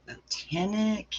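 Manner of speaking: Botanic drawn out slowly and pitched high, "bo-TAAN-ick"; questioning pronunciation. Shown as a demonstration. Host puzzling over how to pronounce it, Botanic slow and high. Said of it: "on how to pronounce".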